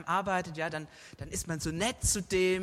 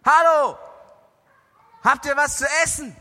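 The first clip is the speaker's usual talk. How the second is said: loud call across the water, pitched high; shouting from the shore; 7 semitones higher, 12 dB louder than usual. Acted. A stranger on the shore calls out to the fishermen, shouting. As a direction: yell out to the boat, hands cupped. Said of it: "schreit jemand so vom Ufer".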